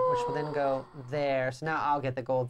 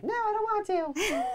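squeaky high imitation of her; high mock whine. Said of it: "And then you're like".

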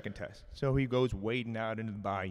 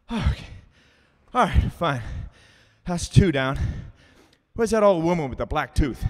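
loud, raised-pitch winded bravado; worked-up fighter; 6 semitones higher, 9 dB louder than usual. Acted. Man back from wrestling a gator, loudly fired up. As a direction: call it out like you just survived a brawl.

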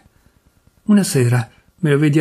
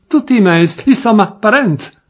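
put-on louder, higher, in a Turin accent; Turin cousin insisting; 5 semitones higher, 4 dB louder than usual. Drunk cousin insisting to everyone, Turin accent. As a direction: brush it off in broad Piedmontese.

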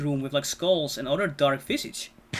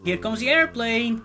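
put-on higher, playful parent feeding a baby; airplane spoon game; 5 semitones higher, 4 dB louder than usual. Shown as a demonstration. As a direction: coax a toddler to take the spoon. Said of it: "you know, like that".

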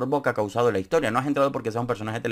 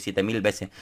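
ordinary talk, nothing marked

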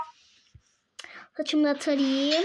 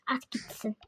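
whispering; 6 semitones lower, 13 dB quieter than usual. Acted. Quiet aside during game play.

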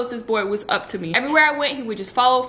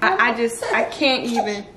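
usual speaking voice

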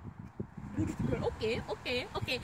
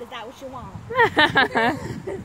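loud drawn-out challenge; 13 dB louder, much slower than usual. Pretend.

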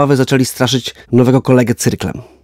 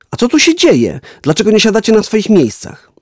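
high-pitched, puzzled narrated question; surprised teacher; 9 semitones higher than usual. A narrator's reading. Teacher finding pupils out of their seats, surprised. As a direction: ask the class in lifted, surprised tones.

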